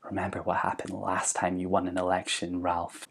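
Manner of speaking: soft sinister whisper. A narrator's reading. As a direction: whisper it into his ear. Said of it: "who whispers in his ear".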